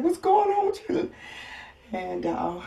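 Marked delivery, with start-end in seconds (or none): 0.0-1.1 much higher, louder mock roommate voice; high, loud imitation of a roommate checking in; imitating roommate. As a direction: squeak it out like the worried roommate. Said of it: "She's like".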